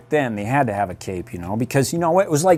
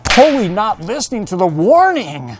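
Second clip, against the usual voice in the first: Robin voice; 8 semitones higher, 5 dB louder than usual. Acted. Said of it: "Everything was holy to Robin".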